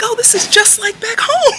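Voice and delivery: high, loud, laughing quote; imitated tickled squeal of recognition; retold excited outburst. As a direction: act out a friend's delighted exclamation. Acted friend's delighted outburst.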